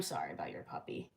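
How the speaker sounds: whispered condolence; soft whisper. Real, not acted. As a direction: breathe the sympathy out in a whisper. Consoling a viewer over dead puppy.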